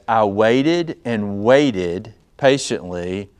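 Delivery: "waited" drawn out slowly both times; emphasis on waited. Shown as a demonstration. Preacher showing the congregation how the verse reads, emphatic. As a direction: lean slowly on each "waited", then "patiently". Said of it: "try to put emphasis on this".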